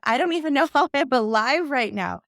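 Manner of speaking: retold line with a laugh in the middle; half-laughing disbelief at herself. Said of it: "She said that".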